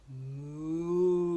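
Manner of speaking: low, drawn-out mooing; cow moo. Retold or imitated. Reading to a toddler, mock cow moo. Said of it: "What sound does her baby make?".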